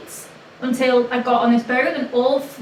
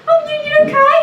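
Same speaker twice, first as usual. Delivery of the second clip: much higher and louder, nervous squeak; retelling her panicked greeting, pitched way up; 8 dB louder, faster than usual. Retold or imitated. Greeting the ex's girlfriend, nervous high squeak. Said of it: "my voice went, like, five times higher".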